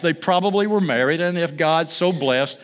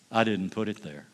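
quiet, lower, heavy-hearted; somber; 8 semitones lower, 8 dB quieter than usual. Acted.

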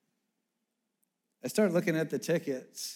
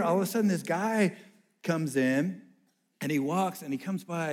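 usual speaking voice

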